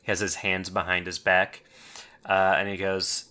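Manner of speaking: usual speaking voice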